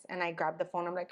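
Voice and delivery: normal talking voice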